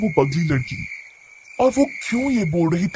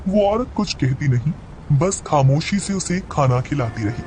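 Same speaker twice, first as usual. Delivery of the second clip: plain reading voice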